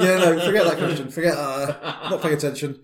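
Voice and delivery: laughing through the words; giggling while backpedaling. Real, not acted. Player laughs at own mistake.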